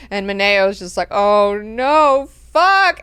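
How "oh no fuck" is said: loud, high, drawn-out dismay; hammed-up alarm; mock dread. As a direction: wail it as doom dawns on you. Servant realises danger, acted.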